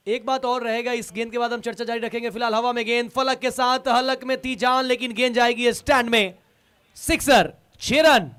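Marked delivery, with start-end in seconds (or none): 2.3-8.3 rising call as the ball flies for six; excited cricket commentary. Real, not acted. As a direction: build up and shout the six. Ball in air, six hit. (उत्साहित cricket commentary)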